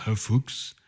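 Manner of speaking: ordinary delivery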